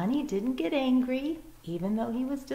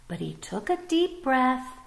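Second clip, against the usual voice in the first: ordinary delivery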